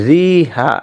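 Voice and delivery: রিহা with a plain i sound; wrong pronunciation. Shown as a demonstration. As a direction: read it as রিহা, the spelling way. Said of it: "এটার সঠিক উচ্চারণটা হবে মাঝ রেহা".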